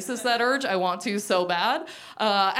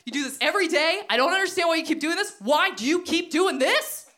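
upset manager; 6 semitones higher than usual. Retold or imitated. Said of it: "She's very upset".

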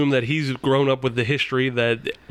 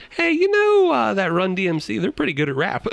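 hammed-up naive observation breaking into laughter; mock-naive remark; 7 semitones higher than usual. Acting out an absurd naive remark.

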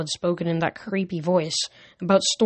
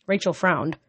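ordinary delivery, nothing marked

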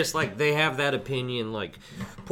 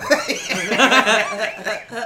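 loud laugh; 12 semitones higher, 11 dB louder than usual. Real, not acted. Laughing at his own joke.

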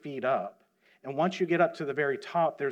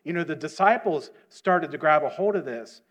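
preaching at full force; 3 semitones higher, 6 dB louder than usual. Real, not acted. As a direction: preach it out to the church, fervently.

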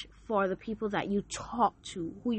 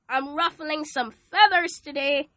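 usual speaking voice

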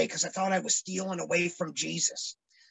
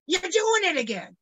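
raised mocking repeat; mock accuser, higher; 9 semitones higher than usual. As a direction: needle them, pitched up. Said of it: "accuser repeats".

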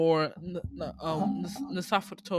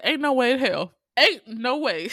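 higher, louder scoffing denial; flat refusal; 6 semitones higher, 7 dB louder than usual. Retold or imitated. Host scoffing at the movie's twist, disbelieving. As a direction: refuse to believe it. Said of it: "I said".

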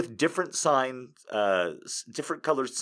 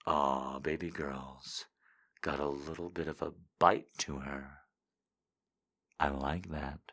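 deep, much lower, soft and slow; seductive voice; 7 semitones lower, 9 dB quieter, slower than usual. Pretend. Reading a viewer quote in a low sultry voice. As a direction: purr it low and intimate. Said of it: "I say it".

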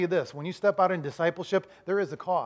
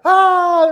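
high, very loud imitated scream; full-blast mock rider's scream; louder scream; 13 semitones higher, 14 dB louder than usual. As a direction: belt out a high "ah". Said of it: "the screams get louder".

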